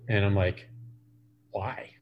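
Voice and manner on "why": retold why, dropped to a near whisper; a soft, baffled why, barely voiced; hushed why. Retelling how he quietly asked his bandmate why, near whisper.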